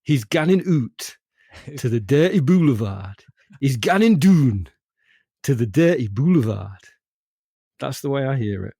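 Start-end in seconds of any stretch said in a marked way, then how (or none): 0.0-3.2 put-on sung in a Geordie accent. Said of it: "I hear it in a Geordie accent". 3.6-6.8 put-on sung in a Geordie accent. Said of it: "I hear it in a Geordie accent".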